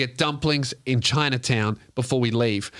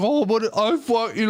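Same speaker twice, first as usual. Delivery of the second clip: put-on drunk girl; 9 semitones higher than usual. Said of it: "and she's like".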